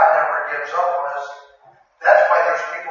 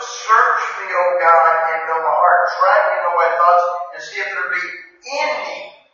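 scripture recital; 3 semitones higher, 6 dB louder than usual. Acted. Recites scripture at full force.

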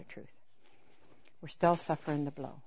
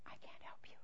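near-silent whisper; hushed refusal; 18 dB quieter than usual. Retold or imitated. God telling an unrepentant person he can't help, whispered. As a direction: whisper it, barely any voice. Voicing God's sorrowful refusal.

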